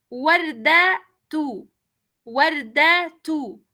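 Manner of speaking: Guarda tú said very slowly, warda-tu, the final u clear; slow Arabic dictation. Shown as a demonstration. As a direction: dictate Guarda tú slowly for the students to hear the ending. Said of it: "voy a pronunciar la palabra con la vocal damma".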